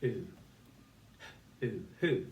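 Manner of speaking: ooh stretched twice, then blended into who; sounding out phonics. Shown as a demonstration. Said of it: "we've got".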